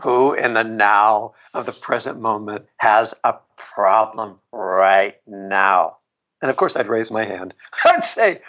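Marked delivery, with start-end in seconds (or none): 0.0-6.0 low, drawn-out teacher impression. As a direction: pose it slowly like a solemn guru. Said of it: "in that teacher voice".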